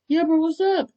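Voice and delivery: high, loud call back; yelled reply. A narrator's reading. Kirishima yelling back up to Bakugo, calling out. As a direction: holler the answer up. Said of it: "I yelled all the way".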